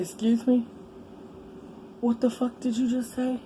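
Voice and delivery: mock outrage at a dumb question; retold angry disbelief. To the baby daddy in the hospital bed, stunned anger. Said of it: "I said".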